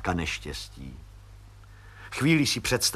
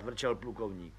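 usual speaking voice

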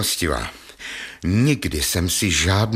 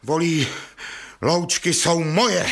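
shouting; 6 semitones higher than usual. Acted. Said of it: "Nekřičte, prosím, nekřičte".

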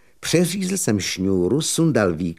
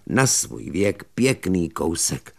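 plain reading voice

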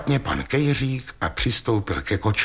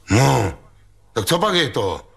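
booming call; 3 semitones higher than usual. A narrator's reading. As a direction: boom it out to the crowd. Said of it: "houkl v tom Ostrohradský".

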